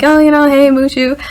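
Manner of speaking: high, smiley re-enacted hello to a dog; sweet sing-out, lightly acted; smiling greeting. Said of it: "I'm literally smiling like".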